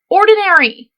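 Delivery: "Ordinary" high and loud; emphatic. Real, not acted. Punchline word for emphasis.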